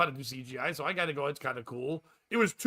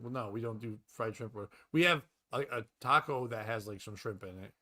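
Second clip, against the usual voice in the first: lower, quieter imitated waitress; apologetic waitress; 7 semitones lower, 5 dB quieter than usual. Waitress offers taco instead of fried shrimp, low and apologetic. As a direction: trail softly through a reluctant explanation. Waitress explains to insistent customer.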